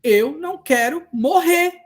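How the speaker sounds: slow, loud, high plea; desperate plea. Retold or imitated. Cancer patient begs the social worker, slow, high and loud. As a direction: voice the patient begging to live. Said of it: "Ela falou assim".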